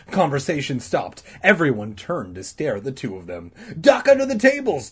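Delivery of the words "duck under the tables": high loud yell; panicked scream. A narrator's reading. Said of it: "Danny screamed".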